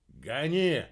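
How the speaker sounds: loud urgent command; shouted command. A narrator's reading. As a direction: call it out sharply to the driver. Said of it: "вскочил на подножку и крикнул".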